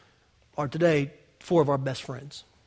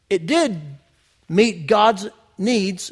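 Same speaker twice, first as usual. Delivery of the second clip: ordinary talk, nothing marked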